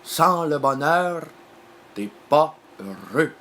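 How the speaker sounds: slow, loud pronouncement; proclaimed old saying. Acted. Quoting an old saying about happiness, loud slow proclamation. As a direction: proclaim it slowly, word by word. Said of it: "il y a un vieux dicton qui l'a dit".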